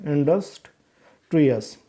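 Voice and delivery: Industrious with a long break between "indus" and "trius"; split into sounds. Shown as a demonstration. Teacher sounding out a word for pupils, industrious slowly split in two. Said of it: "दो sound आ गए".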